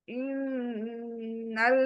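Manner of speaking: slow इन्नल्लाह with a full, round लाम; heavy Allah. Shown as a demonstration. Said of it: "आप मोटा पढ़ रहे हो नहीं पढ़ रहे हो".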